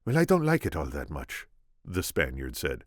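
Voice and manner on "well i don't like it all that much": put-on lightly Spanish-accented Inigo; gentle Spanish accent. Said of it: "my attempt at Inigo".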